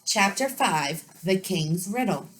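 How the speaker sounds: lively read-aloud for children with voiced characters; story narration. A narrator's reading. Teacher reading a storybook to her students, lively. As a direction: read it brightly to the class.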